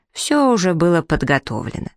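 plain reading voice

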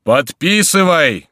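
high, loud, drawn-out command; thunderous shout. A narrator's reading. Monk ordering the king to sign, thunderous shout. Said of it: "кричал Горанфло громовым голосом".

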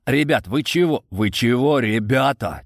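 ordinary delivery

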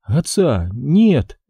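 slow, high broken news; a widow barely getting the words out; quavering grief; trembling voice. A narrator's reading. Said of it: "дрожащим голосом сообщила Зинаида".

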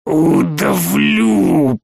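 very slow, rasping threat; hoarse croak. A narrator's reading. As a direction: croak out the threat drawn long. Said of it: "прохрипел Саттор".

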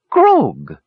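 loud startled yelp. Acted. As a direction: yelp the name in alarm. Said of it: "yelped the Kender".

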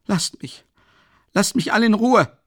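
hoher, lauter verzweifelter Schrei; außer sich schreiend. A narrator's reading. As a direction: schrei es völlig außer dir. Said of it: "schrie Raskolnikow völlig außer sich". (außer sich geschrien)

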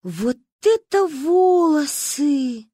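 slow high amazed exclamation; awed. Acted. Girls marvel at the braid, amazed and slow. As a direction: marvel at it slowly.

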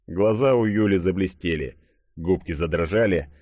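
ordinary delivery, nothing marked

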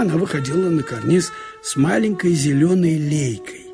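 ordinary talk

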